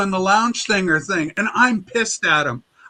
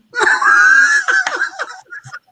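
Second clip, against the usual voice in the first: crying laugh; 4 dB louder than usual. Real, not acted. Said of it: "laughing at the quote".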